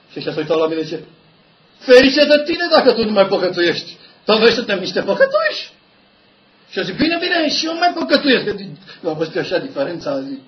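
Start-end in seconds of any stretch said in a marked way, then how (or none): 1.8-5.7 high, loud ironic retort; a high, loud voice of church leaders mocking him. 7.0-8.4 high, loud laughing give-in; a laughing high-pitched reluctant admission; laughing concession. Retold or imitated.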